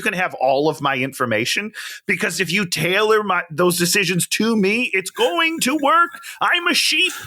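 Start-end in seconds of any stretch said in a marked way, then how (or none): 4.9-7.1 high-pitched, emphatic confession; comic rant. Real, not acted. Co-host owns up to loving targeted ads, high comic rant. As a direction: climb high and proclaim it proudly.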